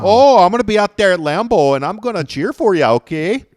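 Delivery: chirpy Wisconsin fan promising support; mock Wisconsin accent. Podcaster playing a Lambeau fan in Wisconsin accent. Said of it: "went back and forth with Wisconsin accents".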